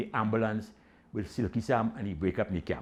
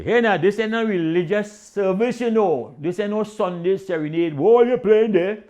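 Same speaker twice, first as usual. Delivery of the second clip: loud raised heckle from the crowd; heckling; 8 semitones higher, 8 dB louder than usual. Retold or imitated. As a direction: jeer at the band, voice up high.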